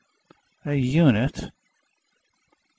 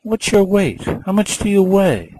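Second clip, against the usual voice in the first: student voice; 8 semitones higher than usual. Retold or imitated. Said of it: "So you say".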